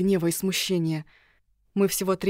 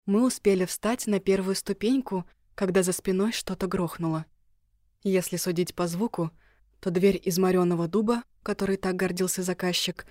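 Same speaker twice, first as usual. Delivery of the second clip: usual speaking voice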